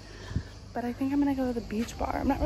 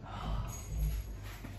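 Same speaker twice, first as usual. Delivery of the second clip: gasp; 10 dB quieter than usual. Real, not acted. Finding the room hot.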